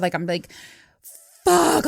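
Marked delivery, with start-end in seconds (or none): 1.4-1.9 high, loud burst of dismay; retold freak-out. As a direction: blurt it out, reliving the shock. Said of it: "I'm freaking out".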